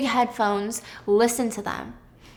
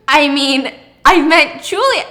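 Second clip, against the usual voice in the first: flustered correction; 4 semitones higher, 9 dB louder than usual. Retold or imitated. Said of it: "She goes".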